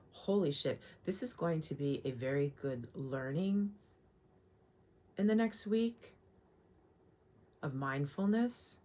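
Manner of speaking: quiet. Real, not acted. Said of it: "stop talking so loud".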